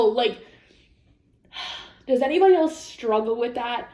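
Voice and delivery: normal talking voice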